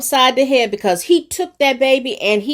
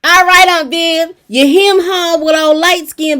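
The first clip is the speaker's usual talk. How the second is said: hyped cheer; 8 semitones higher, 7 dB louder than usual. Real, not acted. Fan loudly cheering Aunt Viv on the TV. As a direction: cheer Aunt Viv on like she can hear you.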